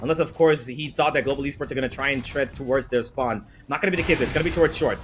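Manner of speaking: normal talking voice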